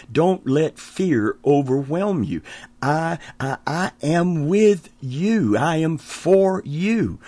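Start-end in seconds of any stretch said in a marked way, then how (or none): 2.8-7.2 slow, higher tender assurance; slow heartfelt promise. Acted. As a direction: stretch it out gently, reassuring. Preacher voicing God's promise.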